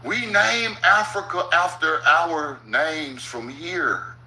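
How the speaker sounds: drawn out, low and slow; slow and deliberate. Shown as a demonstration. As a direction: spell it out slowly for the people in the back. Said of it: "let me say this shit slow".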